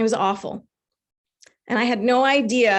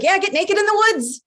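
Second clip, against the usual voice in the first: high-pitched, eager egging-on; insistent inner voice; 9 semitones higher, 3 dB louder than usual. Acted. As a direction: push the dare in a high voice. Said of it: "I hear this voice and it says".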